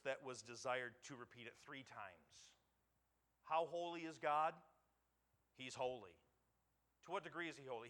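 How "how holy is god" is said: normal talking voice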